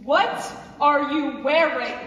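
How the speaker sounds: loud, halting disapproval; appalled questioning. Acted. Mother confronts daughter over her dress, appalled. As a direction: break the question up in horror, hitting it hard.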